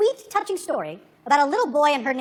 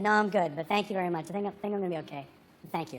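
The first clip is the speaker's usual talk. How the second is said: quiet polite thanks; 8 semitones lower, 11 dB quieter, faster than usual. Retold or imitated. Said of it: "I said".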